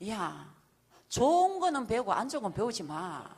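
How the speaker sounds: put-on loud, sharp retort to a child; scolding son. Mother scolding her son who questions her, loud and sharp. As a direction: snap back at your son, full volume.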